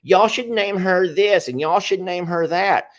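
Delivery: teasing imitation of viewers; mocking commenters. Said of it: "no matter what you're saying".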